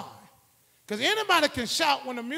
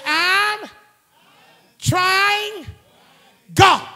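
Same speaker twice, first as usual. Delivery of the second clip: high, loud, drawn-out I'm trying God; confident shout; 6 semitones higher, 7 dB louder, much slower than usual. Shown as a demonstration. Preacher leading the church to declare it, full shout.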